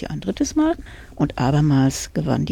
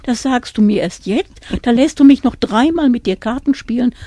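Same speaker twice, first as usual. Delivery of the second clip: louder, higher indignant reproach; 6 semitones higher, 7 dB louder than usual. A narrator's reading. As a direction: scold him for not telling her. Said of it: "rief sie". (empörter Vorwurf, lauter und höher)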